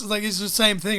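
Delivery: ordinary talk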